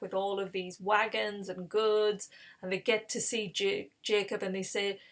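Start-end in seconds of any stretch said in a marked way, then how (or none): none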